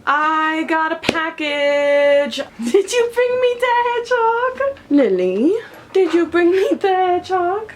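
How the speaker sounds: high, loud dog-voice; pet talk. Real, not acted.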